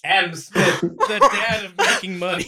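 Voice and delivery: barely getting the words out for laughing; cracking up. Real, not acted. Host repeating the cartoon's silly line, cracking up. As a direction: quote it through real laughter.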